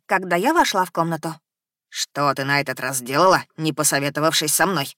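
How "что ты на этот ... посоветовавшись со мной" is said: gurgling tentacle alien. Acted. Eddie the creature scolds.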